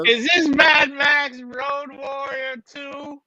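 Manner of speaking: high, drawn-out put-on voice; hammed-up slow, high-pitched confusion; mock drugged viewer.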